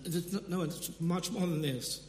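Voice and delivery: ordinary talk, nothing marked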